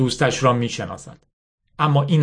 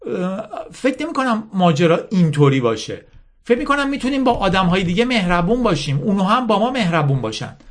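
higher-pitched, lightly acted hopeful plea; hopeful Yasmin; 9 semitones higher than usual. Yasmin urging Mala to work as a team, lightly voiced hopeful plea. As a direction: plead for kindness in character.

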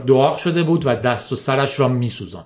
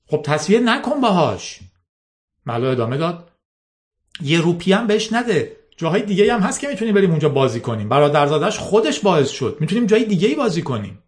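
put-on high, fast, urgent girl's voice; the girl urging her boss not to pay; eager pleading; 5 semitones higher than usual. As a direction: rush the pleading out.

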